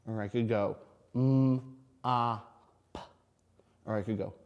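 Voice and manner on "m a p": "m, a, p" slowly, each sound apart. Shown as a demonstration. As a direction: stretch the "m" then "a", "p". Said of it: "I'm stretching out those words".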